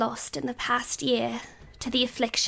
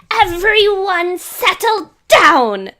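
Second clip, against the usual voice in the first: commanding shout; 8 semitones higher, 10 dB louder, slower than usual. Acted. Calming a shouting crowd.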